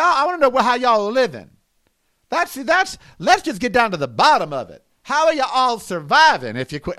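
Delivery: usual speaking voice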